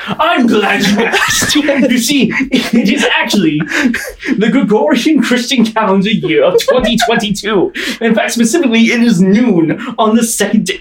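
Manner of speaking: eccentric scientist. Acted. DM plays a scientist NPC.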